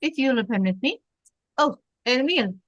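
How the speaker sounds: put-on lightly French-accented police captain. Captain joins the couple and calls the waiter, French accent. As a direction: play Renault with a French accent. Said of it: "I think you do the accent".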